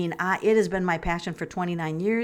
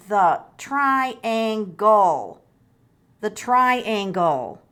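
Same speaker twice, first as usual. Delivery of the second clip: slow naming; 4 dB louder, much slower than usual. Shown as a demonstration. Correcting Melanie, naming the shape.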